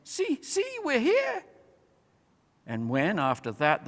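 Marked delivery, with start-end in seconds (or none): 0.0-1.4 much higher tiny squeaky animal voice; squealing with joy. A narrator's reading. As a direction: squeak it like a happy mouse. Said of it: "squealing with joy".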